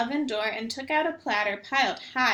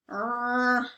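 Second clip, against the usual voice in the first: bear growl. Acted. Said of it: "growled Old Bear".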